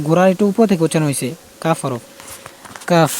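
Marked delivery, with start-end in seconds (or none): 2.9-3.1 কাফ ending in a long puff of air; breathy final F. Shown as a demonstration. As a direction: let the breath hiss out on the end of কাফ. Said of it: "ফার মতন বাতাসে বের করেন".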